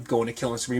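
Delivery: normal talking voice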